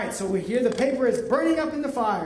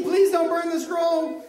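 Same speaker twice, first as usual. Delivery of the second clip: higher-pitched mock plea; acted begging; 4 semitones higher than usual. Officials told to beg the king, acted pleading. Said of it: "You have to beg".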